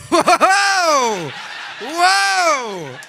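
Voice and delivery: loud, high, amazed whoas into rapid blessings; excited for you. Acted. Onlookers amazed at five sneezes, loudly excited. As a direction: marvel loudly, then pelt them with blessings. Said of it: "people get, like, excited for you".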